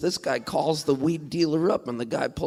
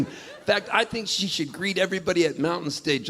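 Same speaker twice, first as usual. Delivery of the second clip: ordinary talk, nothing marked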